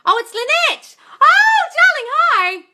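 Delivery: high, loud excited greeting; delighted shout. Real, not acted. Coach shouting hello to a favourite viewer, high and loud.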